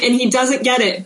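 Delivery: ordinary delivery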